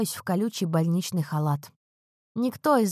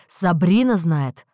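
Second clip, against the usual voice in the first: loud, slow, incredulous growl; growled question; 7 dB louder, slower than usual. A narrator's reading. Husband learns a friend knew first, angry growl. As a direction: growl it, stretching the words. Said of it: "рычит он".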